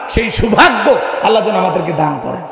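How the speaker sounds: ordinary delivery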